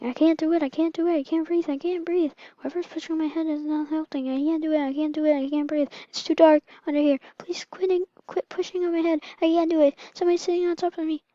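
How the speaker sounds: put-on high-pitched panicked baby voice; rushed, repetitive pretend panic; mock screaming. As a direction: plead frantically in a high, acted squeal.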